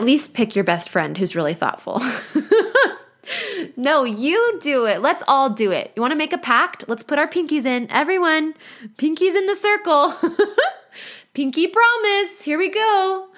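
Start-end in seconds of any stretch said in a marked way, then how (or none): 2.1-13.3 high, bubbly pinky-promise voice; rally everyone giddily into a pact; high excited giggly invitation; playful giddy. Real, not acted.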